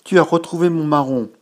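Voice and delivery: high, loud and overjoyed; boy beaming at the moon; thrilled child. Acted. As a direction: burst out with happy gratitude.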